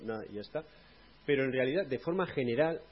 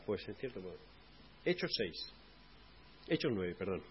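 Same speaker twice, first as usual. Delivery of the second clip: muttered aside; 5 semitones lower, 7 dB quieter than usual. Real, not acted.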